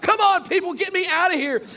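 high, loud mock plea; hammed-up trapped-passenger outburst; frustrated yell. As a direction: beg to be let out in comic desperation. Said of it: "I'm so frustrated right now".

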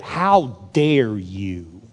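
very slow, low, word-by-word rebuke; stern voice of God. Acted. God writing to the king on the wall, grave slow rebuke. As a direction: weigh each word, deep and grave. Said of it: "I wonder if God wrote".

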